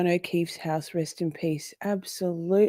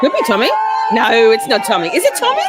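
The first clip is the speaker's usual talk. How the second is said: loud excited hype-man calls; hype man shouting; 5 semitones higher, 8 dB louder, faster than usual. Real, not acted. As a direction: pump up the crowd, louder each time. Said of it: "I'm a hype man".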